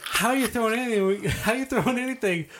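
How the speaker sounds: high mocking carny heckle; hammed-up jester taunt; heckling jester. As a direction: roast him like a jester on a stage. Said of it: "He's like".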